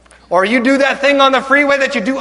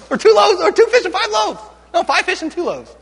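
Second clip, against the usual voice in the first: flustered disciples; 7 semitones higher than usual. Acted. Acted disciples muddle the numbers.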